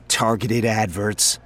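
slow, off-hand coworker voice with a silly hat; a rambling, self-amused office guy; chatty coworker voice, in a different timbre from the narrator; Frink, chatty coworker. Acted. Frink voice again.

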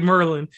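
"Merlin", Maryland squeezed into two syllables; local Maryland pronunciation. Shown as a demonstration. Host telling guest he kept it local, "Merlin" pronunciation. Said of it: "you just kept it straight".

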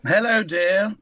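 high, loud sing-song hello dear; camp greeting. Acted. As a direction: echo the greeting up high and bright. Guest echoes the greeting, higher.

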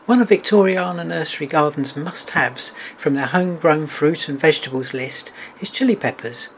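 ordinary talk

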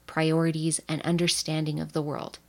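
ordinary talk

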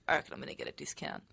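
very soft, low, barely voiced; hushed thinking to yourself; imitated inner thought. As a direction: murmur it like a private thought. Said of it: "in my head going".

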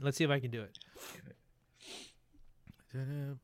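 ordinary talk, nothing marked

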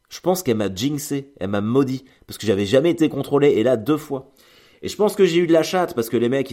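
ordinary delivery

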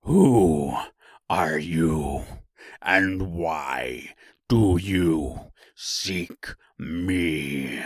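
deep, drawn-out monster demand; great beast's voice. A narrator's reading. Beast questioning the woodman, slow deep roar. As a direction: question slowly from low down, word by word. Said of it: "that was one great roar".

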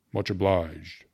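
lightly voiced gruff brevity; brief, curt thanks. A narrator's reading. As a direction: toss off a short, businesslike thanks. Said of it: "he said briefly to Ikey".

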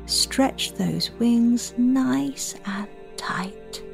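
soft spa whisper. Acted. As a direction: guide the children calmly to breathe. Leads children's breathing meditation.

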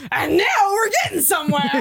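excited cheer. Retold or imitated. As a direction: whoop it like a thrilled fan. Quoting Scott's excitement.

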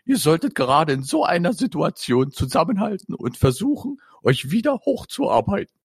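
a mother lovingly settling her sons' quarrel. Acted. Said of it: "sagte Julians Mutter liebevoll".

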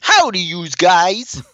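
slow, high cartoonish greeting; hammy 1930s beat cop howdy; weird put-on voice. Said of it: "putting on a weird voice".

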